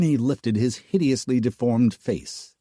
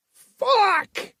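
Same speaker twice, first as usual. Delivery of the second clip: high screamed curse. A narrator's reading. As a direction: scream it out in horror. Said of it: "he screamed".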